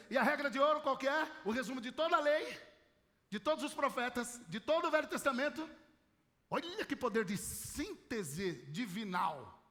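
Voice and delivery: normal talking voice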